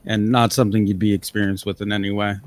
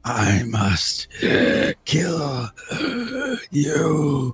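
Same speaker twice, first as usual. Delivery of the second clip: very slow, breath-heavy rasp; menacing coffin creature; 5 semitones higher, much slower than usual. Acted. Coffin figure naming the intruders its enemies, slow whispery menace. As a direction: leave long gaps, rasping out each word.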